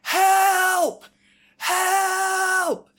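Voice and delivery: high mock cries for help; imitating a man screaming. Retelling the stranger below screaming for help, high mock yells. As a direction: pitch up high like a distant man yelling help. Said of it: "a man just screaming".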